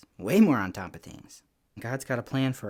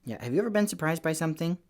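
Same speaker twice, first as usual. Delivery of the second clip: ordinary delivery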